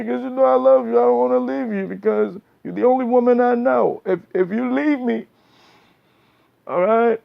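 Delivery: high, anxious lover's plea; pleading boyfriend. Acted. Calmed-down man begging his woman not to leave, high pleading voice.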